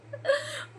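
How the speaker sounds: sudden high laugh; real laugh escaping; burst of laughter. Real, not acted. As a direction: laugh breaking out mid-rant. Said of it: "मुझे इतनी हंसी आ रही थी".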